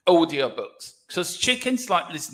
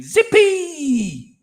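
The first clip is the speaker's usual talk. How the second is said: mock war cry; 11 semitones higher, 6 dB louder than usual. Reading chat's shouted name.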